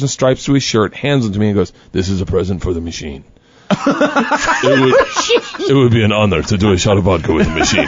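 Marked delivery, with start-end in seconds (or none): 1.9-3.2 low, soft conductor quote; hushed, lowered retold presentation; humble tribute. 3.9-7.9 laughing quote. Retold or imitated. Laughing while quoting the conductor.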